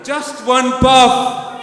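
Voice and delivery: very loud, high drawn-out shout. Shown as a demonstration. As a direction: shout the taunt with full menace.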